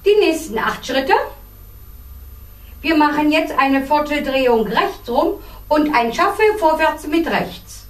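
usual speaking voice

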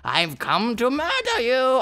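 a lightly voiced cartoon baddie gloating; mock evil villain. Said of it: "What evil villain would I be?".

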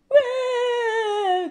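put-on high, loud baby wail; crying wail. George wailing over his lost balloon. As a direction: wail like a toddler. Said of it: "cries George".

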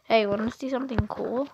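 ordinary talk

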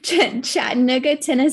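Southern accent on Chattanooga, Tennessee. Real, not acted. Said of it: "My Southern accent might be coming out when I say that".

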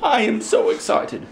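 high, playful thrill; high kiddie-style excitement; put-on excitement. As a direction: pitch it up in pretend delight. Play-acted excitement about cookies.

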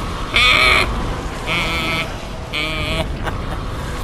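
drawn-out mock snake cry; snake noise imitation. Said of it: "That's the way he sounds".

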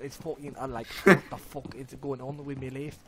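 ordinary delivery

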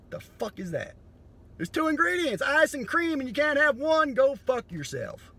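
ordinary talk, nothing marked

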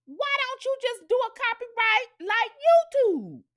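high, loud, sassy challenge. Retold or imitated. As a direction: snap it back at Paramount, loud and high.

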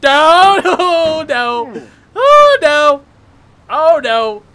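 loud, high cries of no; laughing, panicked outcry; scared wailing. Real, not acted. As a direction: wail in panic as it slips away. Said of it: "I'm scared right now".

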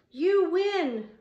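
loud, high-pitched cry of surrender. A narrator's reading. Roy giving in to Dana on top of him, high cry. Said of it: "Roy cried".

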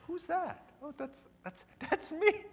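high, mock baffled double take; puzzled surprise. Listener hearing his own voicemail, puzzled high surprise. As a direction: hear your own voice and not place it. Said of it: "we're thinking".

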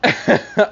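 breathy silent wheeze; wheezing laugh. Real, not acted. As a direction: wheeze without voice. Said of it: "I was wheezing".

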